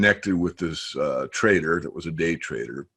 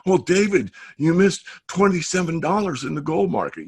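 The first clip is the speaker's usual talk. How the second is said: mock critic; 10 semitones higher than usual. Imagined critic needling him.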